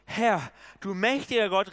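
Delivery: loud, high, drawn-out cry to God. A narrator's reading. Preacher reads Gideon's cry to God, loud and crying out. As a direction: call out in dread to the Almighty. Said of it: "rief er".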